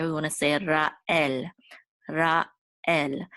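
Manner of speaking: Ra'el said slowly with a clear break between Ra and el; Ra and el separated. Shown as a demonstration. Teacher demonstrates Ra'el, split and slow. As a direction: pull Ra'el apart into two distinct syllables. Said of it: "We don't wanna slur Rael together".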